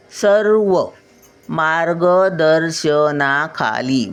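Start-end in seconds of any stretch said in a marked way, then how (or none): 1.5-4.1 मार्गदर्शनाखाली drawn out syllable by syllable; slow syllable reading. Shown as a demonstration. Grandmother teaching children to read मार्गदर्शनाखाली, slowly. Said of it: "reading word for children".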